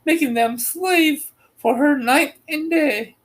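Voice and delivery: put-on high, lilting old woman's voice; little old woman. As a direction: voice her as a tiny kindly old woman. Voices the good witch.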